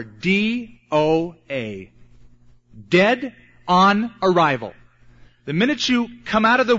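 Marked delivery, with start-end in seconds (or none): 0.0-4.7 letters far apart, then each word punched; slow, emphatic spelling. Real, not acted. Preacher pronouncing your condition, slow and hammered out. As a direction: spell it out slowly, then land the meaning louder.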